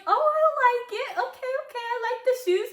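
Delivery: high, bright approving voice; mock cheerful teacher. Teachers praise her mismatched shoes, mock cheerful. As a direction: gush over the shoes like a teacher. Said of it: "Miss Dillon and Miss Reese were like".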